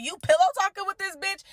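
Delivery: high-pitched, incredulous challenge; retold indignant accusation. Bride confronts fiance about confiding in rival, indignant. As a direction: confront him in outraged disbelief.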